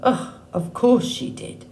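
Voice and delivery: grumbling under his breath; muttered grumble. A narrator's reading. Mister Beaver grumbling about Loudwing, a muttered aside. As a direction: grumble it to yourself, annoyed. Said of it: "muttered to himself".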